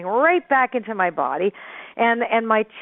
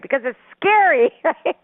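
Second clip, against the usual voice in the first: ordinary talk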